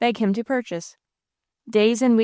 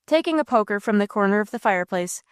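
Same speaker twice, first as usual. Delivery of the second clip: plain reading voice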